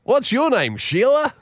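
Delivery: very high, mock-Australian one-liner; bad Aussie accent. Boss topping up his joke at the Aussies, bad Aussie accent. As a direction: ham up a terrible Aussie accent. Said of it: "all in a pretty shitty Aussie accent".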